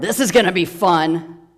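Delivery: put-on loud drunken roar; big threatening drunk roar. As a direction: roar it as a drunk spoiling for a fight. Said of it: "the drunk turned around and roared".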